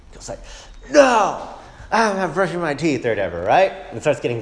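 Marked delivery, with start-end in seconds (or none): 0.9-3.0 put-on high whiny kid refusing; mock a child who won't brush; child's tantrum. Son refusing to brush his teeth, whiny child voice. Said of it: "he starts throwing a temper tantrum".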